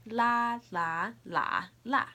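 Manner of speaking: "La, la, la, la" as high level, rising, dipping, falling; four tones in turn. Shown as a demonstration. Teacher showing the difference, "la" in four tones. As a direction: run "La, la, la, la" through all four tones. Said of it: "I'll say the four tones".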